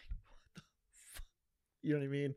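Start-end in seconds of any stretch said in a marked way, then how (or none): 0.1-1.2 whispered swear. Retold or imitated. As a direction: breathe it out unvoiced. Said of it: "you would just be like".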